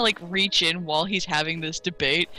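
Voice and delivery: ordinary talk, nothing marked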